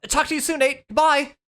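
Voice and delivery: high, cheerful quick farewell; hasty goodbye. Acted. Yancy ending the call with Nate, cheerful hurried goodbye. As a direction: wave him off in a hurry.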